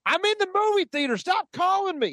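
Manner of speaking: high-pitched mock yell in a Southern accent; imitated Southern yell. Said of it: "He yells this while in the movie theater".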